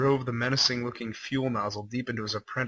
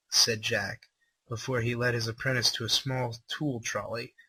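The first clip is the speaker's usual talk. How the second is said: usual speaking voice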